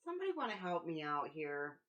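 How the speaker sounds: loud call for backup; exasperated appeal. Acted. Companion asks the group for help persuading, exasperated appeal.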